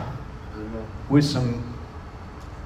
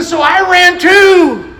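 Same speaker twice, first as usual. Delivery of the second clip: high, loud, drawn-out shout; retold shout; 12 semitones higher, 7 dB louder, much slower than usual. Preacher retells boy fleeing police, shouting. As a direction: cry it out slowly at the climax.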